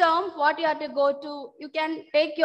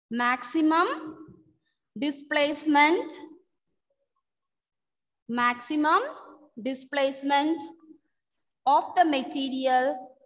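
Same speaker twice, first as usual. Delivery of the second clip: dictation pace. Real, not acted. Dictating a definition for notes.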